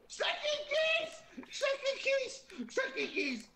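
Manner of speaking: high excited yell; shouting. Real, not acted. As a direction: shout it out, squealing. Yelling out in game.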